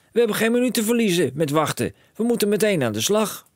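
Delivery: high and loud, fed up; exasperated shout. A narrator's reading. Father snapping at his son, high exasperated shout. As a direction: stop telling me to wait.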